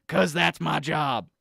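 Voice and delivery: blustery talk-radio conspiracy crank; conspiracy pundit rant. Acted. Pundit badgering the host Sam about Gatorade, blustery rant. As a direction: rant like a paranoid supplement salesman.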